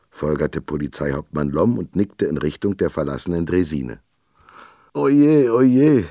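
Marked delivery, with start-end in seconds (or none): 4.9-6.0 higher, louder woeful oje; sighing in despair. A narrator's reading. Shopkeeper sighs over his kidnapped girl, despairing. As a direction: wring out a lament of worry. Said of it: "seufzte Isak Arvid und rang die Hände".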